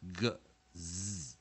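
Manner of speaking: "Gz" as a voiced g plus buzzing z; isolated gz sound. Shown as a demonstration. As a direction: draw out the z in "Gz". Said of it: "或者是".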